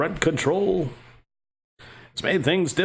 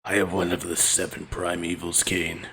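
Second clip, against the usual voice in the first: much lower, softer demon lord; 10 semitones lower, 5 dB quieter than usual. Acted. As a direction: speak like a brooding demon lord. Voicing the demon again.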